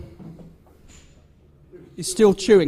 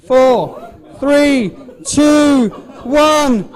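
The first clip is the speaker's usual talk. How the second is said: countdown building to shout; 3 semitones higher, 4 dB louder, much slower than usual. Real, not acted. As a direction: count down, louder each number. Live countdown calling time on contest.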